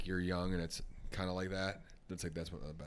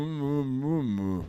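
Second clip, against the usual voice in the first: loud, higher closed-mouth mumbling; mouth-full mumble; 7 semitones higher, 12 dB louder, much slower than usual. Acted. Ice-fishing kid answers grandpa with worms in mouth, mumbling. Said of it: "You have to keep the worms warm".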